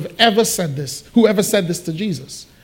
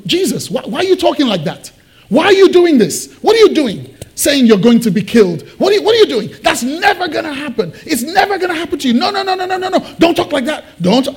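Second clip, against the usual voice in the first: high, urgent, agitated protest; frantic remonstrating Peter; 7 semitones higher, faster than usual. Acted. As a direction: plead and scold in a rising panic. Acts Peter remonstrating with Jesus.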